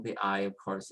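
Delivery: ordinary talk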